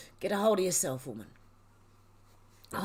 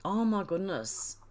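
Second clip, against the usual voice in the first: ordinary delivery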